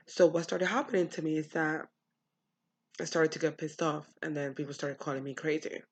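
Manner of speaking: ordinary talk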